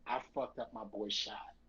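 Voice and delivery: low, soft, guilt-stricken; feeling terrible. Retold or imitated. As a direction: confess it quietly, weighed down with regret. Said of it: "I felt terrible".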